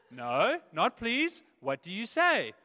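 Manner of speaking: hammed-up parent correcting the kid. Parent correcting the child's answer, loud.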